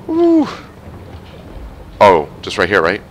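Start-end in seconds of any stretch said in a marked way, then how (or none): 0.1-0.5 high loud yelp; startled high squeal; scared yelp. Real, not acted. Jump scare coming.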